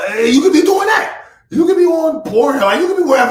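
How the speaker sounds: high, loud, fast hype at viewers; excited shout. Real, not acted. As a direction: shout it out, fired up. Hyped call to his viewers.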